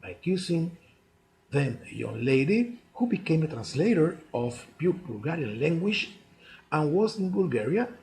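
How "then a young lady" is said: ordinary delivery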